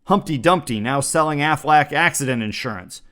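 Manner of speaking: normal talking voice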